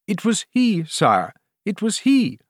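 high, slowing insistence; insistent queen. A narrator's reading. Queen cutting across the king, anxiously insistent.

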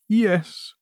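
high, faint-hearted assent; cowed queen. A narrator's reading. Queen growing paler under questioning, cowed. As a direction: give in, high-pitched. Terrified queen answers the king.

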